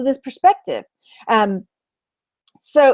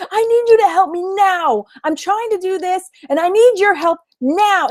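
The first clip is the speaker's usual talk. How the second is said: all-caps voice; 6 semitones higher than usual. Retold or imitated. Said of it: "all caps".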